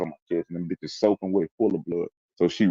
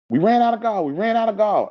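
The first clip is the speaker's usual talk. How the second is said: very high, louder retold alarm; frantic reenacted call, pitched way up; urgent calling out; 4 dB louder than usual. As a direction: imitate a medic shouting for supplies. Ambulance man calls out in retelling.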